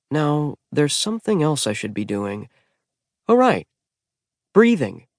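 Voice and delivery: remember suddenly, wry; dazed realization. A narrator's reading. Magnus underwater remembering to breathe, dazed then jolted.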